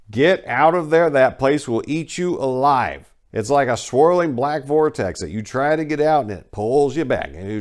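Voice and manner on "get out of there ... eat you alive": higher and louder urgent plea; urgent warning. Real, not acted. Host warning viewers who live in Memphis, loud urgent plea. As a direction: call it out like a real warning.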